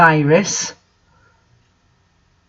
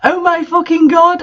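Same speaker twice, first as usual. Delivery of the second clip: high, hammed-up panicked cry; mock terrified outburst, pitched way up; scared shout; 10 semitones higher than usual. A narrator's reading. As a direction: wail it like a B-movie scream. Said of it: "I shouted in a scared voice".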